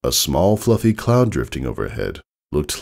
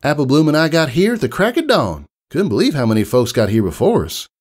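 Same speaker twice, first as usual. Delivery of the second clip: put-on high, louder Southern farm girl; Southern country mare; 10 semitones higher, 3 dB louder, faster than usual. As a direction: give it a down-home Southern twang. Applejack voiced in story.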